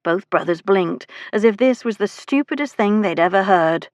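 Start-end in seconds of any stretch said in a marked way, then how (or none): none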